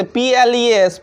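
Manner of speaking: normal talking voice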